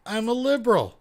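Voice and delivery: high, loud incredulous echo; mocking repeat. Host mocks the caller's line to viewers, loud and high. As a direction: echo his words back in disbelief. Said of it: "what did he just say".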